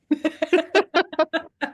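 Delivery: high, loud burst of laughter. Real, not acted. Laughs at her own joke.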